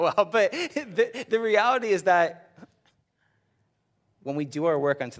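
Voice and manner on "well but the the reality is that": talking through a real laugh, higher. Real, not acted. Preacher still laughing about the coworker story.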